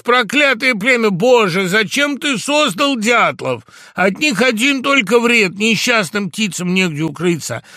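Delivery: high, loud, drawn-out tirade; furious cursing. A narrator's reading. Fox cursing all woodpeckers, furious tirade. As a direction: curse them bitterly at full voice.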